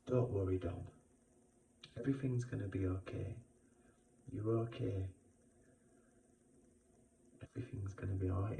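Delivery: hushed and gentle, almost under the breath; softly murmured comfort to oneself; soft reassuring inner voice. Retold or imitated. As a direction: soothe yourself quietly, like a kind inner voice. Retold inner voice reassuring him.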